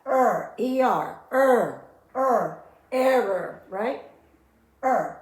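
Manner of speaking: "er" repeated loud and slow; sounding out er. Shown as a demonstration. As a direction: stretch and repeat "er" firmly. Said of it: "pronounce them together".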